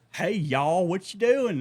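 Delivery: loud, high, hammed-up Southern greeting; exaggerated Southern twang, mocking; mock Southern accent. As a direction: imitate a Northerner faking a Southern accent. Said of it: "talking with a southern accent".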